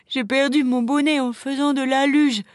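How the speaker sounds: put-on high, tearful little boy; hiccuping sobs. A narrator's reading. Crying boy explaining his lost hat, sobbing. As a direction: whimper it through acted sobs. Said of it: "répondit-il dans un hoquet".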